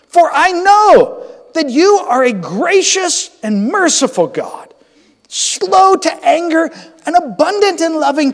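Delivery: Jonah crying out to God, loud and pleading; anguished Jonah praying. Acted. Preacher reads Jonah's prayer to God, anguished and loud. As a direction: plead with God like a prophet who wants to die.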